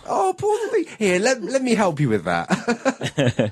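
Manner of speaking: high, slow cooing with a laugh; baby talk. Pretend. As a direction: comfort it like a toddler. Mock comforting the drinker.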